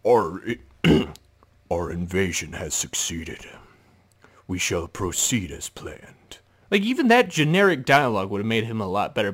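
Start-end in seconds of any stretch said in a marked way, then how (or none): 0.1-6.4 low, husky menacing villain voice; deep villain. Shown as a demonstration. Critic showing how the villain should speak, deep and menacing. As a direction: sink low and measured, like a dark overlord. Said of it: "He should s-, be saying".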